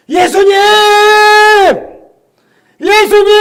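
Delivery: high, loud calling out across a distance; desperate call. Retold or imitated. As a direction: call out to him from far away. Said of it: "열 명이 소리 지르는 거예요".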